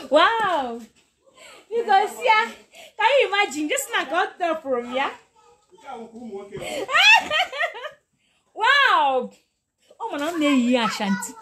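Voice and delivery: real giddy joy, squealing thanks; overjoyed. Real, not acted. Teacher overwhelmed by a viewer's gift, high laughing tearful joy.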